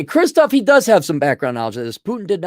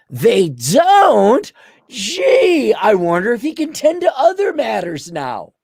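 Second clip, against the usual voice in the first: mock surprise; 9 semitones higher, 3 dB louder, slower than usual. Pole mocking about Putin.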